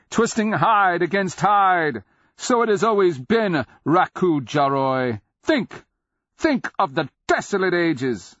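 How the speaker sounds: loud, high ringing jeer; cried taunt. A narrator's reading. Cleric goading the beast, crying out. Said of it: "Cleric cried".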